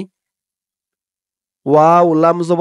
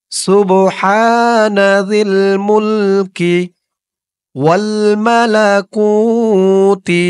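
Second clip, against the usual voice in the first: slow chanted সুবহানা জিল মুলকি; slow recitation; 3 semitones higher, much slower than usual. Shown as a demonstration. Teacher models the phrase for viewers to repeat, chanted. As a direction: recite it long and even for repeating. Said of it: "আমি যেমনভাবে উচ্চারণ করব".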